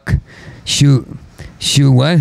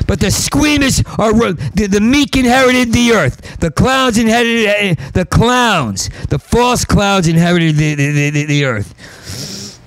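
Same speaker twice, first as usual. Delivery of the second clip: higher, bitter rant; angry rant; 8 semitones higher than usual. Real, not acted. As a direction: rant with fading fury. Ranting, still raised.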